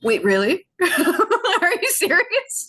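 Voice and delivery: high, slow, laughing disbelief; amazed disbelief. Retold or imitated. Learning the song's meaning, laughing disbelief. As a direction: gasp it out half laughing. Amazed the song matches her vision.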